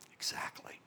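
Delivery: a breathy whispered agreement to himself. Retold or imitated. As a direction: whisper it like a secret thought. Retelling inner reply to little voice.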